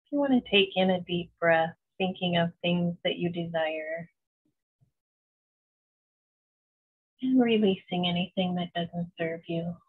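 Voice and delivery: slow, even meditation voice with long pauses; unhurried, soothing visualization, letting each phrase settle; guided meditation. Acted. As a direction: guide them gently into calm, phrase by phrase.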